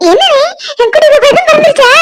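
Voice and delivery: high, laughing excitement; overjoyed. Acted. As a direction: bubble over at baby news. Learns of her brother's newborn. (மகிழ்ச்சியில் துள்ளும் குரல்)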